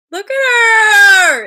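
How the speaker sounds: long, loud, high squeal of delight; adoring squeal. Real, not acted. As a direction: stretch it into a doting shriek. Showing another photo of her niece.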